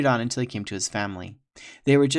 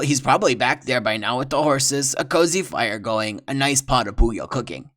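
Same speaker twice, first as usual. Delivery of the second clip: oily French trapper; 3 semitones higher, 7 dB louder than usual. Acted. Villain lying sweetly.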